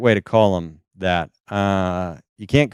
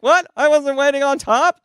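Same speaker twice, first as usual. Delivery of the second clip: high-pitched comic incredulity; mock disbelief. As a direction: protest in a startled high voice. Acts surprise at therapist's correction.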